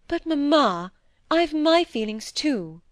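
high, drawn-out objection; protesting daughter. A narrator's reading. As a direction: interrupt mother with an injured protest.

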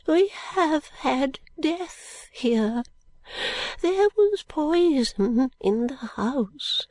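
high, slow, hushed, ending in a whisper; a frightened old woman confessing; subdued, trembling. Acted. Miss Flite tells of the poison, hushed and trembling. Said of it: "in a subdued voice and trembling".

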